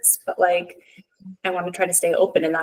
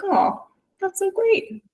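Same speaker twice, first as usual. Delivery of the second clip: much higher, slow, gushing mock delight; 13 semitones higher, slower than usual. Mom imitating her reaction to her kids' answer, high and gushing. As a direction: swoon over it in a high drawn-out voice.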